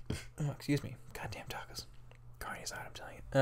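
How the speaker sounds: whispering off to the side, half to himself; a quick whispered excuse under his breath; whispered aside. Real, not acted. Talking to viewers, whispering an apology for the tacos.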